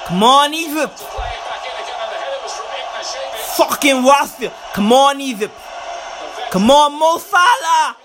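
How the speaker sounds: fan calling out at the match; urging the team. Real, not acted. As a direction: egg the players on, frustrated.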